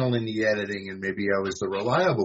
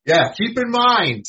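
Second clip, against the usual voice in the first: loud, much higher exclamation; pitched way up and louder, mocking yourself; mock emphatic; 11 semitones higher, 7 dB louder than usual. Real, not acted. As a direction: exclaim it at your own memory blank. Mocks own blank memory.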